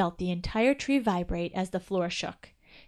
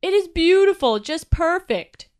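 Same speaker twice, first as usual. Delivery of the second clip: high, loud, slowing delight; proud father admiring the lit tree; 8 semitones higher, 9 dB louder than usual. A narrator's reading. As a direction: savor every word of it. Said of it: "Chili heard the father say".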